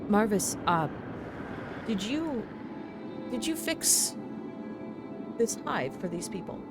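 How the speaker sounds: high, curious, musing tinkerer; a chirpy inventor probing questions. Acted. GM plays V character.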